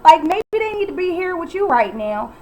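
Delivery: high, loud and slowed, exasperated; indignant outburst. Real, not acted. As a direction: call her out in disbelief.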